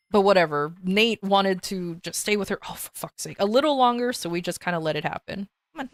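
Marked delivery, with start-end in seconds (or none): 2.7-3.4 whispered under the breath; a real whispered grumble; muttered aside. Real, not acted. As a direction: hush it to yourself, annoyed. Annoyed aside while streaming.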